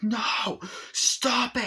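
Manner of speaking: high, urgent order to stop; alarmed yelling. Acted. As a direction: shout to hold a friend back. Friend stopping him, acted.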